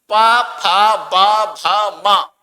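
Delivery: पा, फा, बा, भा, माँ called out loud; loud teacher drilling of पा फा बा भा माँ; loud demo. Shown as a demonstration. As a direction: hammer the lip syllables out for the class. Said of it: "इसका मतलब ये है कि".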